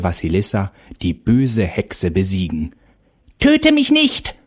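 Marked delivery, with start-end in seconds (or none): 3.4-4.3 high, loud begging hag; a beaten witch cries for her life; defeated Baba Yaga begs the mother; pleading witch scream. A narrator's reading. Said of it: "schrie die Baba Yaga".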